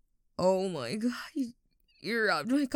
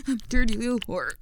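fast, louder rushed line; louder and faster; 4 dB louder, faster than usual. Shown as a demonstration. Told to say it faster, a rushed louder line. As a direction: rattle it off faster on command. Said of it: "say it louder and faster".

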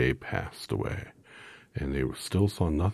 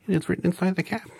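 very high, tiny squeak; squeaky mouse voice; 4 dB louder, faster than usual. A narrator's reading. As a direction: squeak like a little mouse queen. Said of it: "replied the Queen of the Mice".

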